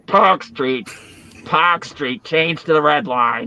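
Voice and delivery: put-on Boston announcer accent, dropped r's. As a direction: call out the stop like a Boston subway announcer. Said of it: "they had that Boston accent".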